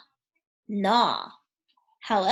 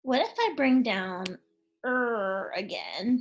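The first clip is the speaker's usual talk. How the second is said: normal talking voice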